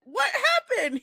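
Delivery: high-pitched, startled retold question; alarmed question. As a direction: act out startled disbelief up high. Acts out her alarmed question.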